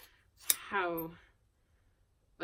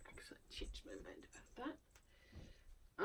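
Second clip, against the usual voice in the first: whisper to self; 18 dB quieter, faster than usual. Real, not acted. Muttering to herself over sweaters.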